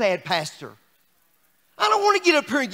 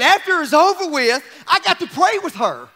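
preaching joy; 5 semitones higher, 4 dB louder than usual. Acted. Preaching at full force.

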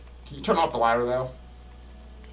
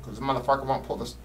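ordinary talk

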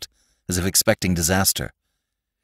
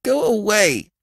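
high, plaintive protest; sniffling dismissal. A narrator's reading. Robbie sitting with hands over ears, plaintively dismissive. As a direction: whine it to send him off. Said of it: "sniffed Robbie".

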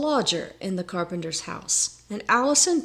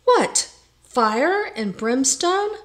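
slow, high, loud disbelief; alarmed question; 5 semitones higher, 5 dB louder, slower than usual. A narrator's reading. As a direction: carpenter aghast at the end of the world. Carpenter alarmed at world's end.

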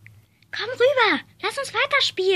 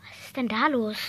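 much lower, quieter teacher voice; stern teacher; 10 semitones lower, 7 dB quieter, faster than usual. Acted. Teacher asks the rowdy class what's happening, low and stern. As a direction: drop low like a teacher checking the noise.

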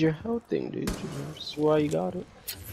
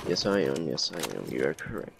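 lowered, mock-solemn agreement; deep mock voice; 10 semitones lower, 5 dB quieter than usual. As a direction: drop the voice very low and soft.

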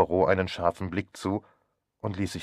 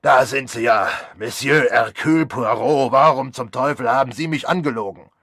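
loud, high accusing yell; furious shout; 5 semitones higher, 9 dB louder than usual. Acted. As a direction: tear into the liar at full volume. Granger confronts Poirot furiously. (wütender Doktor schreit Poirot an, laut und hoch)

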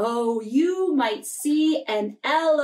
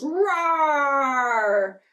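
playful pretend lion roar for kids. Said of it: "the lion goes".

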